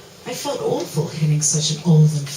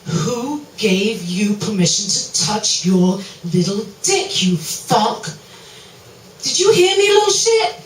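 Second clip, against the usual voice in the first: angry shout; 5 dB louder than usual. Acted. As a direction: start slow and menacing then blow up into a shout. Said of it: "That sounded a bit weak".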